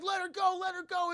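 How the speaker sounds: high, loud acted plea; urgent mock shout. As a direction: shout for the hostage's release. Friends plead for hostage.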